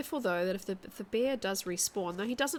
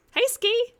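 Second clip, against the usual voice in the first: high, loud excited hail; much higher, louder hello; calling out a greeting; 12 dB louder than usual. Real, not acted. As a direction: sing out a greeting from across the room. Hailing an arriving viewer.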